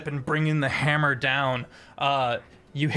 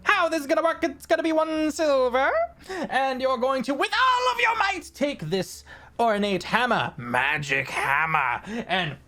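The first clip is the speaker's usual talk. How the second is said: portly halfling barker; 11 semitones higher than usual. Acted. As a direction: hawk the game like a jolly fairground halfling. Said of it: "a very portly, rotund halfling goes".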